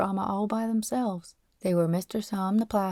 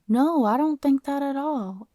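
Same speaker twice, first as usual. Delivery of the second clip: high-pitched, earnest denial; earnest protest; 7 semitones higher, 4 dB louder than usual. Acted. Boy denying he thinks badly of Paul, earnest protest. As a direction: rush to reassure an offended friend.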